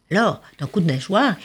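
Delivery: plain reading voice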